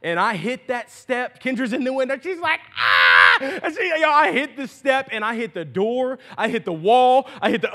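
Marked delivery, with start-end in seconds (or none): 2.8-3.4 loud mock scream; imitated scream. Wife at the window watching husband fall, imitated scream. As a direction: cry out loudly as the startled wife. Wife sees him trip, shrieks.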